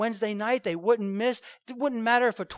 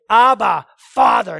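"Abba, Father" cried out much louder, each word falling; emphatic cry; 2 semitones higher, 15 dB louder, slower than usual. Shown as a demonstration. Preacher shows the congregation how the cry to God sounds, loudly emphatic. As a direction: call out to a father with full force. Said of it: "it's emphatic there".

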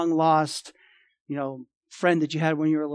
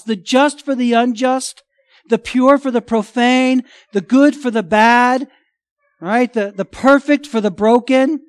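loud, high, driving sermon climax; full-force preaching; 6 semitones higher, 9 dB louder than usual. Acted. Pastor preaching the cross to his congregation, loud and fervent.

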